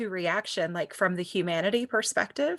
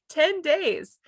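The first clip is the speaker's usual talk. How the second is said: higher, laughing through it; laughing disbelief; 8 semitones higher, 3 dB louder than usual. Real, not acted. Mother recalling ten days away, laughing incredulously. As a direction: laugh at how long it was.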